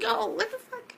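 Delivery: very high, squeaky disbelief; shocked reaction. Retold or imitated. Reacting to the demand for blood, shocked. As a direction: shoot the pitch right up, baffled.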